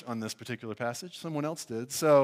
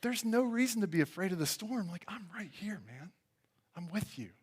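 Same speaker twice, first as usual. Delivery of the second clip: hushed, tender reassurance; soft and close, comforting a frightened friend; Jesus reassuring; 4 semitones higher, 8 dB quieter than usual. Retold or imitated. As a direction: drop to a gentle near-murmur. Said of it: "feels like a rhetorical question to say".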